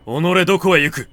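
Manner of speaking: put-on a servant yelling at a fleeing old woman; sudden enraged cry to stop her; furious shout. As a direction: bellow it out high and loud. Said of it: "こう罵った".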